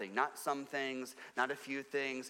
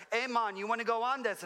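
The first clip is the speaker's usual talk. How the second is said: higher-pitched attempt at a Jamaican accent; 8 semitones higher, 4 dB louder than usual. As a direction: imitate a Jamaican man calling out an offer. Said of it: "that was my best Jamaican accent".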